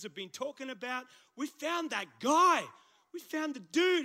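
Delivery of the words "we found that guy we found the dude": excited. Real, not acted. Excited over greatest news ever.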